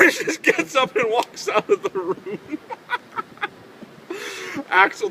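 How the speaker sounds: words pushed out through loud high laughter; real laughter over the story's end; laughing while telling. Real, not acted. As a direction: crack up at the punchline. Laughing at his own punchline.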